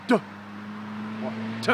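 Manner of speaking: "duh" and "tuh" pushed out with air; forceful duh. Shown as a demonstration. Coaching a student on a harsher call. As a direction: puff the "duh" out hard from the diaphragm.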